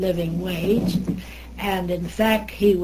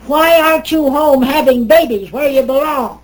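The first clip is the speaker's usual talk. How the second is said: loud, high barking outburst at a woman visitor; gruff congressman; 7 semitones higher, 10 dB louder than usual. Retold or imitated. Old congressman bellowing at a woman lobbyist in his office, loud and high. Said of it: "and he said".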